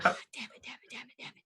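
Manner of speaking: hushed, repeated whispered cursing; quiet frustration; muttered cursing. Acted. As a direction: curse under your breath, fading to a whisper. Player frustrated at failed task.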